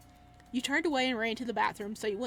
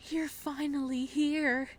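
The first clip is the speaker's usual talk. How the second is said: slow, louder menacing welcome; creepy killer greeting; 5 semitones higher, 5 dB louder, slower than usual. Acted. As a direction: greet her like a pleased murderer.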